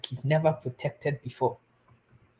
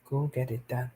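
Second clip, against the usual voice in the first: quieter, lower repeat of "Go get it done"; soft repeat; 4 semitones lower, 7 dB quieter than usual. Shown as a demonstration. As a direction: drop to a soft, low, earnest plea. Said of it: "I know why I'm emphasizing this".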